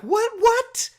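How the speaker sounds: escalating shocked what; baffled repeated what. Retold or imitated. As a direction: squeak out each what in disbelief. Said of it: "And I'm like".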